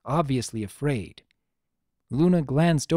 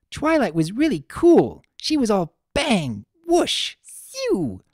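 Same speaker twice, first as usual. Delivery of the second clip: much higher, louder, bouncy party-pony voice; bubbly Pinkie Pie; 9 semitones higher, 4 dB louder than usual. Acted. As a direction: bounce through the sound effects. Pinkie acts out the fight.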